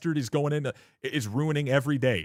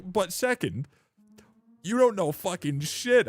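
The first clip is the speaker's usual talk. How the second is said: high, slow, leaning-in yell; retold furious yell; 7 semitones higher, slower than usual. Streamer retelling final insult to a teen viewer, slow high yell. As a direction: hammer each word high and heavy. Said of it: "I was like".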